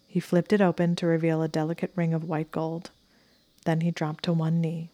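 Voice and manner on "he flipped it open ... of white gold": ordinary delivery, nothing marked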